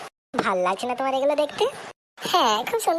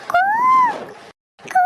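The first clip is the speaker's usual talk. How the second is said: playful cooing call; teasing hoot; 5 dB louder than usual. Acted. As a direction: hoot to pester the lovebirds. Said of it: "ওদেরকে একটু disturb করে দিই".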